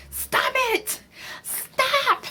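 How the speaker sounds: high shrill pleading stop; dramatic mom. Retold or imitated. Kenya pulling drunk Latoya away, high pleading. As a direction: beg her to stop like a frantic mom. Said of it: "And Kenya is like".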